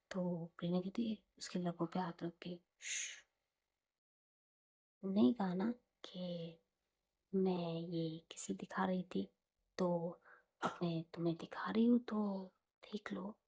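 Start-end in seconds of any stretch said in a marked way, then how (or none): 2.8-13.4 soft, mostly whispered teasing; teasing hush. Retold or imitated. Prerna hushing Saanjh with a hand on her lips, softly whispered. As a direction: hush her and tease her gently.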